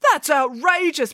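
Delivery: high, drawn-out indignant protest. A narrator's reading. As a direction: protest as if wrongly accused. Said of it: "protested Jason".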